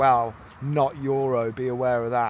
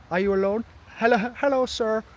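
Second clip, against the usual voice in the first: higher, louder put-on Scandinavian accent; mock Scandinavian accent, pitched up; imitated local accent; 10 semitones higher, 6 dB louder, faster than usual. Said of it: "I love the bloody accent".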